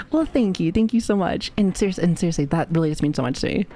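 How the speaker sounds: usual speaking voice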